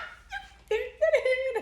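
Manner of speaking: high screeching laugh; loud burst of laughter. Real, not acted.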